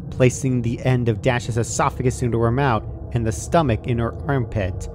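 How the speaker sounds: ordinary delivery, nothing marked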